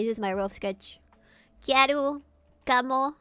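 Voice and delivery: ordinary talk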